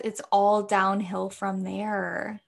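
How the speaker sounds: normal talking voice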